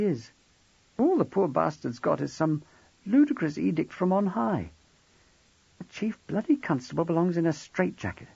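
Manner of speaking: high woman's voice, shaken and upset; a frightened wife pouring it out; tearful young wife. Acted. Narrator voices Jackie.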